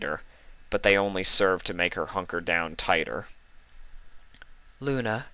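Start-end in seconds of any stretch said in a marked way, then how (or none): 4.8-5.3 gentle call. Acted. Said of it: "came a familiar voice".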